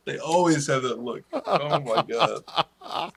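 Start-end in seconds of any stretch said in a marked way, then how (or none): 1.3-3.1 laughing. Real, not acted. Laughing at the joke.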